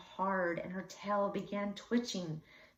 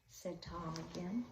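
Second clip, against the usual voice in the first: ordinary delivery, nothing marked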